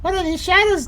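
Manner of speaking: high, incredulous question; baffled, pitched well up; high-pitched bewilderment. Real, not acted. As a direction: ask it high and baffled, rising at the end. Puzzled repeat at game shadows.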